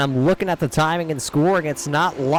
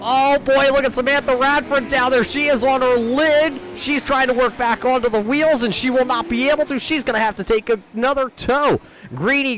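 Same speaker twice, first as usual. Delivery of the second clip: excited commentator; 9 semitones higher than usual. Real, not acted. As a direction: react to the car upside down. Car flipped on its lid.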